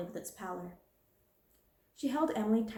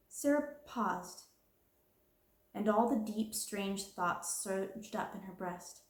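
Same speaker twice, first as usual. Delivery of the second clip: usual speaking voice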